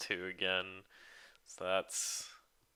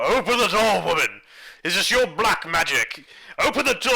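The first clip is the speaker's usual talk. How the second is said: loud, higher angry yell; furious shout building higher to the end; shouting demand; 8 semitones higher, 9 dB louder than usual. Acted. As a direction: bang on the door and yell at the woman. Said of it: "Walks up to me and be like".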